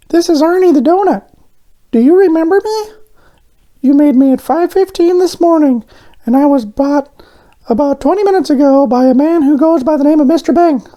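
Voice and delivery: very high, loud, urgent little voice; high urgent donut. A narrator's reading. Arnie phones the baker, high and urgent. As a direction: rush the warning out.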